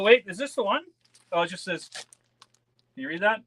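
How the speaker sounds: ordinary talk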